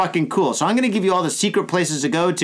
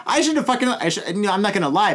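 ordinary delivery

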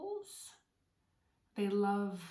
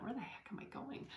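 whispered inner question, much softer; thinking aloud; 3 semitones higher, 9 dB quieter than usual. Retold or imitated. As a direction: whisper it to yourself, puzzled. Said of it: "I thought".

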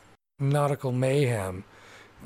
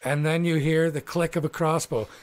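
ordinary delivery

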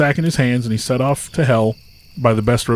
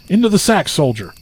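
shouted command; 6 semitones higher, 4 dB louder than usual. A narrator's reading.

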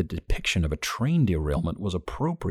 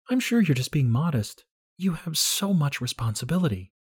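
put-on young woman June; 9 semitones higher than usual. A narrator's reading. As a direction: flatter him gently in June's high voice. June voiced by narrator.

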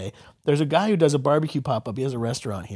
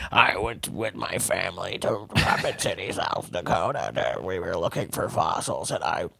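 low, soft mumbling RFK impersonation; RFK impression; 9 semitones lower, 10 dB quieter than usual. Mocking RFK, low mumbling excuse. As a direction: mumble it like a rambling old politician. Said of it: "He was like".

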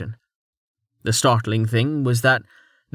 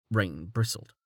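ordinary delivery

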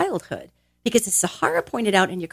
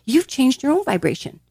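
ordinary talk, nothing marked

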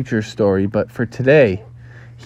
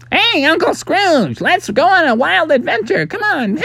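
very high, squeaky duckling voice; cartoon kid begging for adventure; excited kids. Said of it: "some kids".

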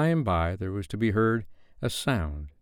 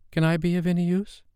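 small, high-pitched polite offer; timid; 10 semitones higher than usual. A narrator's reading. Kite tail brushing Peter as if offering help, timid. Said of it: "as if saying timidly".

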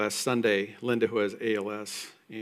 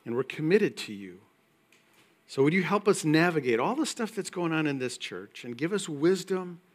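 earnest, louder pastoral prayer; closing prayer; 2 semitones higher, 5 dB louder than usual. Acted. Pastor praying over the standing congregation, earnest prayer. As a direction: lift the church up to God.

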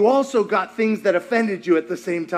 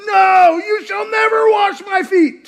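shouted high and loud, with NEVER punched; Peter's shouted refusal; 10 semitones higher, 6 dB louder, slower than usual. Retold or imitated. Peter shouts at Jesus, refusing the foot washing. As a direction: bellow the refusal at the Lord. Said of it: "He goes".

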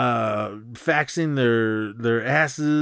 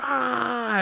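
high, held "aah" at usual volume; mock party scream; 12 semitones higher than usual. Everyone partying in the office, mock party scream. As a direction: imitate a crowd whooping. Imitates crowd partying wildly.

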